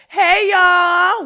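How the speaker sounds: big high call of hey y'all; loud playful hey; excited greeting. Real, not acted. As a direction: sing out the hello to the audience.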